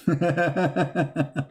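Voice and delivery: chuckling laugh. Real, not acted. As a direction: laugh it out in quick even bursts. Amused at fishy neighbor story.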